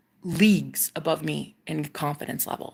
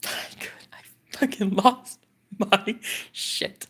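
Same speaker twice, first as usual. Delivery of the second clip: awestruck whisper; 2 semitones higher than usual. Real, not acted. Overwhelmed by the memory.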